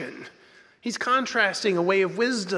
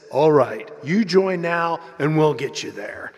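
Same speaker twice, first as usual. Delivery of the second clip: lowered, punched announcer voice; 2 semitones lower, 5 dB louder than usual. Retold or imitated. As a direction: concede the deal in a promo announcer's voice. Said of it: "finally the voice says".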